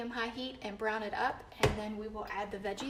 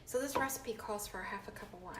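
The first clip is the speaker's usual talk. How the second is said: soft unvoiced whisper; 5 dB quieter than usual. Real, not acted. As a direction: whisper it quietly. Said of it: "Why are you whispering?".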